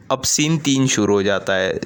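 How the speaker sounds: ordinary talk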